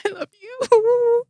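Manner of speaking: high, slow laugh through real tears; laughing cry. Real, not acted. Daughter telling her mom she loves her, laughing through tears. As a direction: laugh and cry at once, it's overwhelming. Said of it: "I'm getting emotional too".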